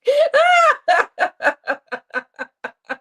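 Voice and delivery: high, loud shrieking laughter. Real, not acted. Streamer cracking up at the video, loud high laugh. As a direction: burst into a shrill laughing fit.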